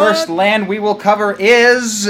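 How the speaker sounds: building to a loud high reveal; grand announcement. Real, not acted. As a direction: announce it like a game show host. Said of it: "Take it away, [name]!".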